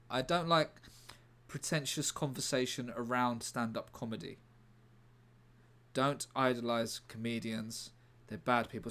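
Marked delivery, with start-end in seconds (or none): none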